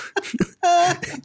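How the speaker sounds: high burst of laughter; real amused laugh. Real, not acted. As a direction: crack up at your own story. Laughs at the deadpan scene retold.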